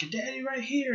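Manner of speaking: high-pitched taunting imitation; mocking a coworker. Ken claiming to be his dad, high mocking voice. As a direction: mimic his boast, pitched far up. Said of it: "He's like".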